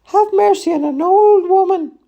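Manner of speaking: very high, loud plea; desperate begging. A narrator's reading. Frail old woman begging her accusers, in fear. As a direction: beg for mercy in a high old woman's cry. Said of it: "She begged".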